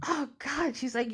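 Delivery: ordinary delivery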